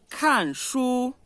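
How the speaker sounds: "kan shu" falling then high level; fourth plus first tone. Shown as a demonstration. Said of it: "Quarto e primeiro". ("kan shu": caindo, depois alto plano)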